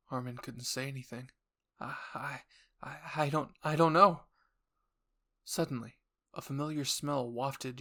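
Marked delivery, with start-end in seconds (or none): 1.8-4.3 stammering. Acted. Armin stammering, lost for words.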